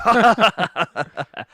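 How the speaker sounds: high, loud mock laughter; hammed-up laugh at one's own joke; imitated gloating laugh. George laughing at his own zebra jibe, gloating.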